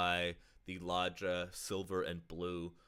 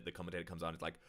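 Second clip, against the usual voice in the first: normal talking voice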